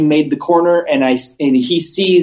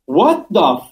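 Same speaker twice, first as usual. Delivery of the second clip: higher, drawn-out disbelief; retold bafflement; 7 semitones higher than usual. Speaker walking past the councilman, baffled. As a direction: stretch it out, utterly baffled.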